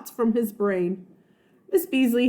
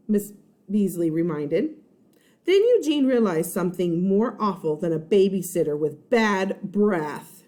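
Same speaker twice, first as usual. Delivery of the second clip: plain reading voice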